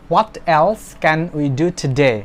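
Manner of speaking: very slow, every word separated for the class; slow model sentence. Shown as a demonstration. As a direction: read it out slowly like a dictation. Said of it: "teacher models the English sentence". (ধীরে ধীরে ক্লাসে পড়ে শোনানো)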